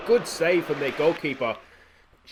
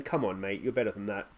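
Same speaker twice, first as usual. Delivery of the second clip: low and much softer, almost to himself; quiet encouragement; 8 semitones lower, 9 dB quieter than usual. Real, not acted. Manager quietly urging his nervous debutant, low and soft.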